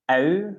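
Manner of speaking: ou opened, then closed like a trumpet; trumpet-lipped vowel. Shown as a demonstration. Teacher showing the trick, ou closed like a trumpet. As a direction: glide ou from open to rounded lips. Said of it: "you open it and then close it like a trumpet".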